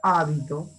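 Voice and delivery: Hábito with the opening A leaned on; stress on first syllable. Shown as a demonstration. Teacher reading an esdrújula word, stress on HÁ in Hábito. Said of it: "Es una palabra esdrújula".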